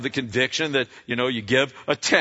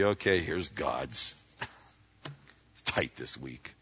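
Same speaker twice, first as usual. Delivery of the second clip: grudging mumble; 9 semitones lower, 8 dB quieter than usual. Retold or imitated. Mimicking reluctant duty giver.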